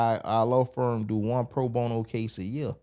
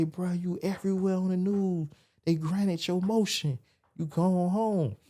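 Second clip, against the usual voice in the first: lightly voiced homeboy bursting with news; excited friend; 8 semitones higher than usual. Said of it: "He say".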